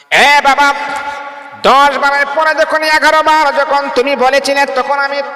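high, ringing sermon chant; fervent waz preaching, pitched high and loud; impassioned preaching. Acted. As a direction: lift the voice and call out to the crowd. Preacher reenacts father-son story.

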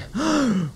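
re-enacted big gasp for air; bocanada de aire. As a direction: gasp as if breathing for the first time. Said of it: "yo hice como que". (gran bocanada de aire, recreada)

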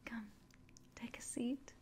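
ordinary talk, nothing marked

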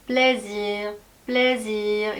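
plaisir with a long z and a weak final r; z drawn out. Shown as a demonstration. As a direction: stretch the z in plaisir. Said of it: "Z를 오히려 끌면서".

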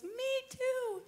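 very high, soft little voice; high, drawn-out tearful imitation; sister's squeaky echo.

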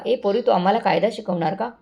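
ordinary delivery

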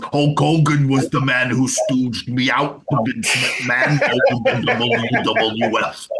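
slow put-on ex-wrestler voice; Jesse Ventura. Host as ex-governor accusing Hogan to amuse his friend, slow impersonation. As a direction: draw out the accusation like an old wrestler. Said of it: "It's good, that".

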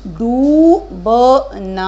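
डूबना with a stretched long ऊ, slow and loud. Shown as a demonstration. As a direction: model डूबना slowly. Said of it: "क्या word बनेगा ये?".

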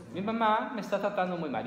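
put-on high whiny tattling kid; child as victim. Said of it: "La víctima". (niño quejándose con papá, voz aguda)

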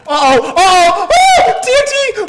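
high, loud excited shrieks; excited yelling. Real, not acted. Gamer spotting TNT, shrieking high and loud. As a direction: yelp with gleeful alarm. Reacting to explosion in game.

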